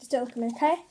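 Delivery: ordinary delivery, nothing marked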